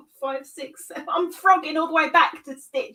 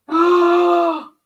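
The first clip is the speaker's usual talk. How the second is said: surprised gasp; 4 semitones higher, 8 dB louder than usual. Real, not acted. Gasp at finding mistake.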